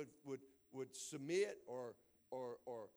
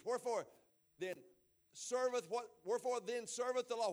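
raised, solemn King James reading; scripture reading; 8 semitones higher, 8 dB louder than usual. Acted. Pastor reading scripture to the congregation, raised and solemn. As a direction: read the old verse out with weight.

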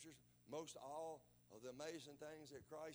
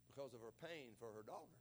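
normal talking voice

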